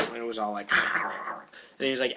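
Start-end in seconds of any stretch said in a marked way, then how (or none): 0.8-1.4 breathy mock tiger growl; rasping big-cat noise; imitated tiger roar. Tiger answers to its name.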